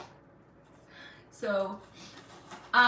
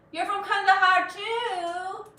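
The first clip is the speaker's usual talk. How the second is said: higher, slow, with a catch of joy; thrilled to meet a viewer from Kandahar; delighted surprise; 10 semitones higher, 5 dB louder, slower than usual. Real, not acted. To a viewer from her hometown, delighted surprise.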